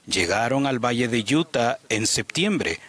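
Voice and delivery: usual speaking voice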